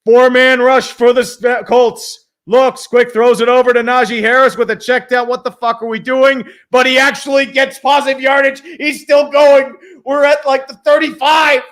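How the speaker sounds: lively game call, building up; play-by-play. Acted.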